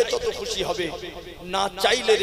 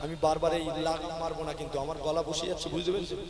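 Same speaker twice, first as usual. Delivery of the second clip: much softer, quick conversational talk; soft, easy chatting; 5 dB quieter, faster than usual. Real, not acted. Preacher with a tiring throat eases off, soft chatting. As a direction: hold back and save the voice. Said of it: "তাহলে আস্তে আস্তে গল্প করে করে".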